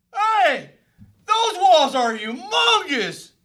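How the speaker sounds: high, loud exclaim; astonished. Acted. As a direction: shout at the huge walls. Spy sees huge walls.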